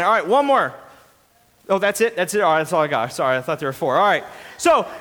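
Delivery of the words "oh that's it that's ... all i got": ordinary talk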